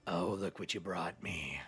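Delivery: low, slow, breathy demon whisper; sinister whispered gloating; creepy creature whisper. Acted. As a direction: hiss it like something hungry. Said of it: "you hear the creature go".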